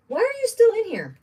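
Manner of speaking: high cooing pet voice; high sing-song voice for the dog. Real, not acted. Talking to her dog.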